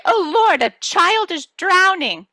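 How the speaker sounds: very high, loud panicked cry, drawn out slowly; high loud mock panic; alarmed. Alarm at drowning child.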